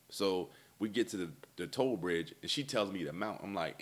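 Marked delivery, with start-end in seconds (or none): none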